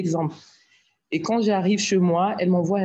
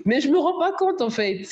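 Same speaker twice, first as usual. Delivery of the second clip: high-pitched, incredulous protest tipping into a laugh; amused disbelief; 8 semitones higher than usual. Retold or imitated. Speaker telling the club about praise for her voice, amused disbelief.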